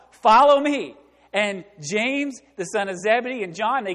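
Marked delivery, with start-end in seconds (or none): 0.1-1.0 commanding call. Acted. Jesus calling fishermen, sermon.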